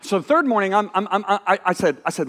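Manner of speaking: ordinary delivery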